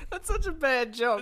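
high, slow and full of laughter; laughing groan. Real, not acted. Co-host reacting to a terrible punchline, laughing. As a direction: groan it through helpless laughter.

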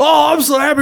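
high, overjoyed puppet monster; Sweetums. Acted. Big monster thrilled to be picked, overjoyed high voice. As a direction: squeal with goofy happiness. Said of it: "He goes".

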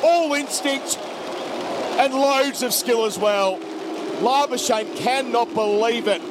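excited live play-by-play, pitched high; cricket commentator. Real, not acted. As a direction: call the wicket as it happens. Live wicket call, highlights.

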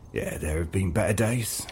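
soft, low, breathed reply. Acted. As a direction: sigh the words out softly. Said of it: "he breathed".

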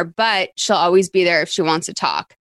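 ordinary delivery

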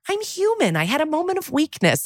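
high, put-on sitcom woman voice; Susan Sharon. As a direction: play a high-voiced, grinning confession. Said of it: "such a Sex and the City character".

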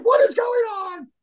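very high, mock girlish question; confused little girl. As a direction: ask in high bewildered voice. Confused daughter in the chaos.

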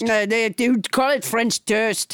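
mock French accent; French Trump. French Trump explains the translation to co-host, French accent. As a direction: explain it in hammed-up French tones. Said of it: "your extremely realistic accent".

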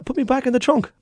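put-on high, pleading old man; panicky stowaway. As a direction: beg to go back. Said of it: "He said".

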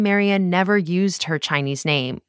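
ordinary talk, nothing marked

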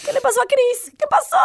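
high, worried questions; alarmed. Real, not acted. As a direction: demand to know what happened. Alarmed about missing co-host.

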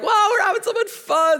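put-on high, loud childish shout; hammed-up gleeful kids yelling; mock excited kids. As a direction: squeal like kids having fun. Kids excitedly playing Simon Says.

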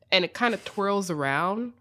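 normal talking voice

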